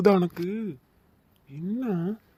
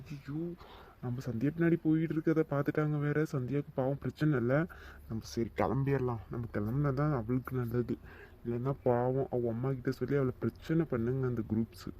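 soft, lower, to himself; quiet worried mutter; 5 semitones lower, 8 dB quieter than usual. Acted. Boy quietly deciding to leave before trouble. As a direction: back off and mumble it under your breath.

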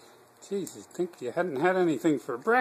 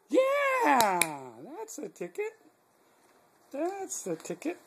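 excited praise; 9 semitones higher, slower than usual. Acted. Praising dog after bark.

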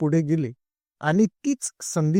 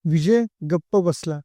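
plain reading voice

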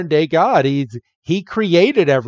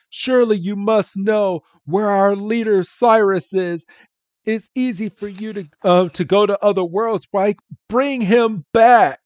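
pleading villain; 6 semitones higher than usual. Retold or imitated. Jupiter demanding Cyrus back.

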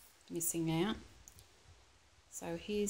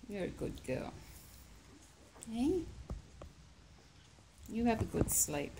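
soothing a scared pet; 6 semitones higher than usual. Real, not acted. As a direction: coo her gently to sleep. Settling the dog to sleep.

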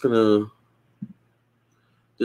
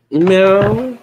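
high, louder meow imitation; cat meow; 8 semitones higher, 5 dB louder than usual. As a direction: meow at the cat to call him. Calling the cat.

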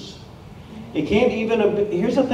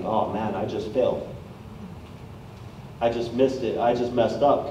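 low, soft, deflated murmur; a mock-dejected sigh of a line; defeated inner voice; 5 semitones lower, 9 dB quieter than usual. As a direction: sound like you just gave up. Voicing defeated inner thoughts.

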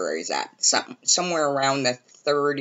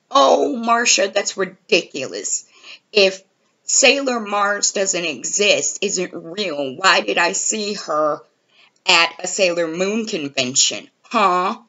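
imitating Justin; 5 semitones higher than usual. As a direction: scoff like he's won. Justin's retort, quoted.

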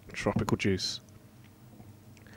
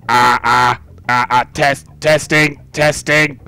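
stiff mechanical robot voice; 3 dB louder, slower than usual. Acted. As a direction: speak like a machine. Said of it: "like, a robotic kind of voice".

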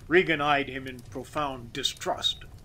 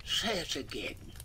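softened, disbelieving murmur; stunned near-whisper; 6 dB quieter than usual. A narrator's reading. Master mechanic stunned by worker's wish, hushed near-whisper. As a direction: drop to a hushed request. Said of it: "whispered the master mechanic".

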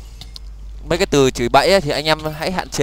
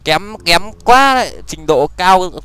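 mocking; 4 semitones higher, 3 dB louder, slower than usual. Real, not acted. Mocking poor play.

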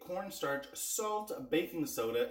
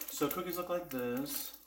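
ordinary talk

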